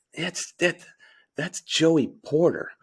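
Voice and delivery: a lightly voiced hushed friend, leaning in; Joe's voice. Friend leans over to whisper a tip, lightly voiced hush. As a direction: hush it like a buddy tipping you off. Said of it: "in his Joe voice".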